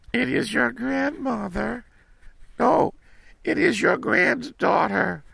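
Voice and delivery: put-on very high, timid girl voice; little girl voice. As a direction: answer like a small girl. Girl answers at the door.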